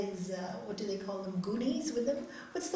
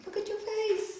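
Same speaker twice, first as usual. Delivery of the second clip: teasing mock; 10 semitones higher than usual. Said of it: "And I go".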